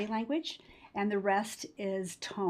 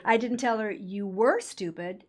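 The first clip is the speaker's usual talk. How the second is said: "were" lifted above the other words; emphasis landing on past-tense "were"; stress on WERE; 4 dB louder than usual. Shown as a demonstration. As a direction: lean on the word "were". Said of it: "because you still are stupid".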